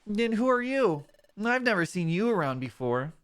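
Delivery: gentle, lightly voiced young buddy; partner Mudkip voice. Mudkip partner telling about the Krabby bubbles, lightly voiced. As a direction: describe the sunset bubbles dreamily.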